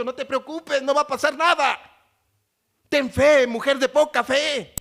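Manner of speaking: rising pulpit fervor; fervent preaching. Acted. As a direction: preach it out to the congregation. Preacher building a hypothetical.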